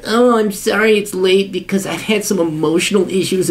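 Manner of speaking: imitating a tearful whiner; whiny millennial. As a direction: whimper the excuse in mockery. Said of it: "You're like".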